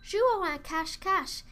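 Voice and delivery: put-on children calling for hide and seek; children shouting. A narrator's reading. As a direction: shout it out like excited kids. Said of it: "crièrent les enfants".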